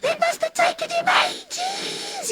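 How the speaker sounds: very high giggling voice; giggly brother. Acted. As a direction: giggle through the line. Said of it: "my brother is giggly".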